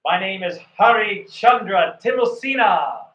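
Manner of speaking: high, loud, projected introduction; loud and clear. Shown as a demonstration. Coach projecting the name loud and clear for the student. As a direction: announce the name like a broadcast. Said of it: "loud and clear and slow".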